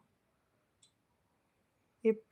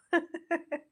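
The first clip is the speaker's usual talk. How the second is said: short high-pitched giggle; a quick amused giggle; 8 semitones higher, 4 dB quieter than usual. Real, not acted. Giggling at Umesh on the live stream.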